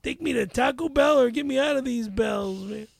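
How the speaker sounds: caricatured Mexican accent; broad mock-Mexican accent. Shown as a demonstration. Rapid-fire accent request from host, mock Mexican.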